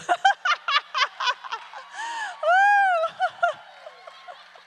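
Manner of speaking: high, loud helpless laughter. Real, not acted. Preacher cracking up at her own story to the congregation. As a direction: crack up completely.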